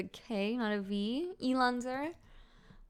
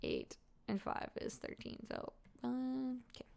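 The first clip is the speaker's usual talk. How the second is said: muttering to self; 4 semitones higher, 10 dB quieter than usual. Real, not acted. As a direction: count quietly to yourself. Counting damage under breath.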